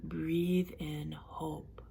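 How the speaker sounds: calm relaxation coach leading a breathing exercise; guided relaxation. Acted. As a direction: guide them gently through breathing, unhurried. Leads a guided relaxation session.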